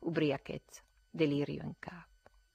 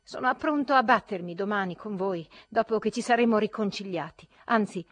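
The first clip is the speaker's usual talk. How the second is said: feverish challenge; 6 semitones higher, 12 dB louder than usual. A narrator's reading.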